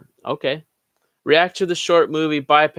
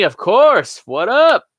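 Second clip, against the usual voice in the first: loud, high, hearty hello; excited greeting; 8 semitones higher, 6 dB louder, much slower than usual. Pretend. Host greeting a remembered viewer, excited. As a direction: hail them loudly, pitched up.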